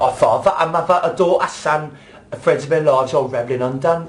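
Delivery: heavy, overdone London accent; exaggerated London accent with "mother" said as "muvva"; thick London accent. Shown as a demonstration. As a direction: lay the Cockney on too thick to follow. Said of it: "you can't be like".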